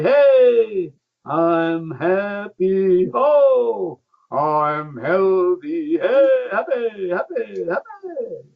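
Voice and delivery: loud, high, slow calls of joy; joyful laughter-yoga cheer. Shown as a demonstration. Yogi showing Amanda his laughing way, high joyful calls. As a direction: shout happiness up high and stretch it. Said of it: "what do you think of my way of laughing".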